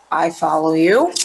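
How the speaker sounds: low detective voice, following the reasoning; lightly played low-voiced detective; detective catching on. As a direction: drop the pitch for the detective's reply. Detective replies to Olivia in play.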